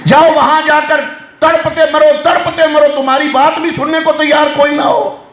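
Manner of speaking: a preacher scolding the congregation, building up; rising sermon rebuke. Acted. Preacher rebuking sons who abandon parents, rising fervour.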